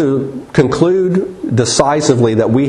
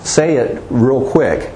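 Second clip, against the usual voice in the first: normal talking voice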